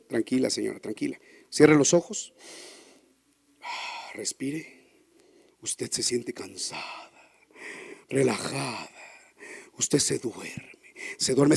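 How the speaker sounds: low, soft, slow hypnotic voice; hypnotist lulling. Retold or imitated. Hypnotist lulling the wife into sleep, low and soft. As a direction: lull her to sleep.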